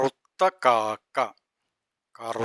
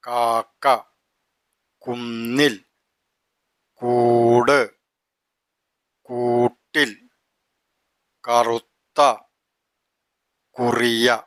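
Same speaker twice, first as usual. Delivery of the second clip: slow reading; slower than usual. Shown as a demonstration. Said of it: "slow reading demo".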